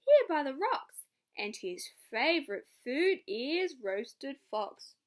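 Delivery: loud, slow teasing mouse; sly mouse. Acted. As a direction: lean on the threat slowly. Mouse scares fox.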